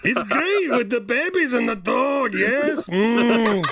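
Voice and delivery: put-on high hammy Russian accent; Russian villain accent. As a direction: pitch up, broken English villain. Continues the villain bit.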